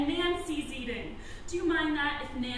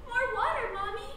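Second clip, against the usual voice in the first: mock child's plea, pitched higher; pleading child; 6 semitones higher, 5 dB quieter than usual. Acting daughter Nancy begging mother for water, pleading. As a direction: lightly voice a thirsty child pleading. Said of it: "Nancy cries".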